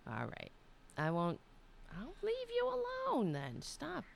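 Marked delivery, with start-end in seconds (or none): 2.0-4.0 much higher cooing to the cat; high playful voice backing off from the cat. Real, not acted. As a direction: coo it like to a pet.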